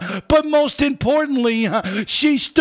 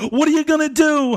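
usual speaking voice

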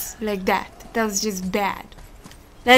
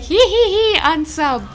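high mocking giggle, louder; 7 semitones higher, 4 dB louder than usual. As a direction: tease like a snickering troll. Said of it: "They're like".

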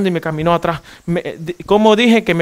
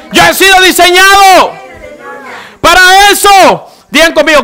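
full-force preaching; 6 semitones higher, 5 dB louder than usual. Real, not acted. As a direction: preach it loud, building to a shout. (prédica a toda voz)

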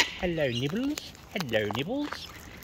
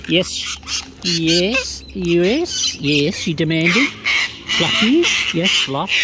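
excited pet talk; 2 semitones higher, 8 dB louder, much slower than usual. Real, not acted. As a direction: greet the demanding bird with loud delight. Excited greeting to demanding bird.

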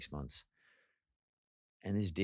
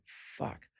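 a breathy, voiceless curse under the breath; whispered curse; 5 dB quieter than usual. Retold or imitated. Thinking aloud when the homeless man is gone, a whispered curse. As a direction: let the dread slip out as a whisper.

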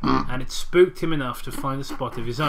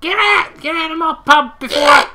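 high shrill landlady shout; Peggy Mitchell; 12 semitones higher, 10 dB louder than usual. Retold or imitated. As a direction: scream them out of the pub. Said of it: "Peggy Mitchell".

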